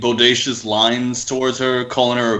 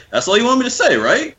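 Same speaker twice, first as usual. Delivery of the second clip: much higher, puzzled mock question; high mock voice actor; 13 semitones higher, faster than usual. Friend imitates the voice actor's puzzled question, high and mocking. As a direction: squeak it out high and baffled. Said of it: "He's like".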